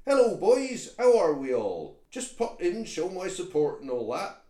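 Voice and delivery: loud, high West Country toad; toad accent. Acted. Toad greets the boys at the meeting, loud West Country accent. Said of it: "he's talking foreign like".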